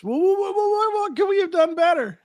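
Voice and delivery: hammed-up panic, tripping over the words; mock flustered stammer. Owner begging a leaving customer, high mock-flustered stammer.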